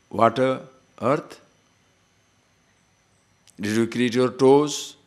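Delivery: ordinary delivery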